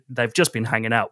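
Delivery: usual speaking voice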